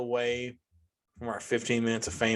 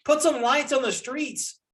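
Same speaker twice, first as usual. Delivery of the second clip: high, drawn-out exasperated plea; exasperated demand; 12 semitones higher than usual. Real, not acted. Griping to viewers about dark roads, exasperated high plea. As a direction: beg the city for streetlights.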